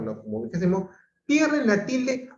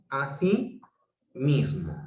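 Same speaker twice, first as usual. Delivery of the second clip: así mismo split by a clear pause; two separate words; 2 semitones lower, slower than usual. Shown as a demonstration. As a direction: keep así and mismo apart. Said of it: "cuando los juntos digo".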